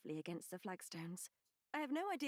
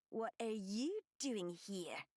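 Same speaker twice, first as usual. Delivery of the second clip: growling, slow; slower than usual. A narrator's reading. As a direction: growl it out slowly. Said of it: "she growls".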